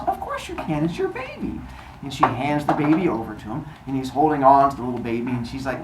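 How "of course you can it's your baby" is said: high mock sweet voice. Kronos in high put-on voice.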